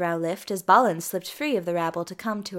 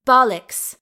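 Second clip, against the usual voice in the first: loud, higher-pitched scoff; blunt dismissal; 4 semitones higher, 10 dB louder than usual. A narrator's reading. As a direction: dismiss it forcefully. Dees dismisses her brother's denial.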